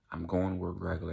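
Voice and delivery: normal talking voice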